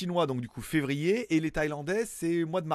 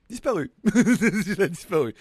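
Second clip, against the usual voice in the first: amused, higher-pitched laughing words; 4 semitones higher, 7 dB louder, slower than usual. Real, not acted. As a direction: say it through a real laugh. Finds something vanished, laughs.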